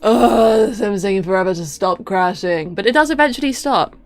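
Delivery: normal talking voice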